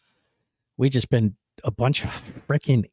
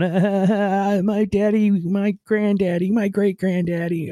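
much higher, whiny mock-crying voice; mocking whine; 11 semitones higher, slower than usual. Host mocking a complainer's lament, high mock whine. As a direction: mimic a complainer, wailing about your ancestors.

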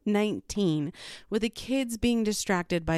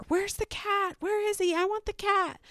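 imitated child scream; 13 semitones higher than usual. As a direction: imitate a little girl screaming for her pet. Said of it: "makes her scream harder".

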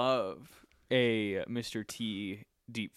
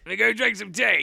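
mock Cockney accent; 8 semitones higher, 6 dB louder than usual. Continuing the accent bit.

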